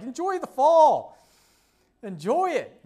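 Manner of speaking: loud, high exhortation; emphatic urging. Real, not acted. Preacher urging the congregation, loud and high, to enjoy fall. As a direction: plead with them to enjoy it.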